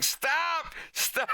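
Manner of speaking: very high, strangled pleading; panicked cries. Retold or imitated. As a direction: shriek for him to stop. Fox being strangled.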